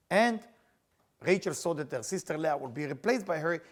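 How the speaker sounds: normal talking voice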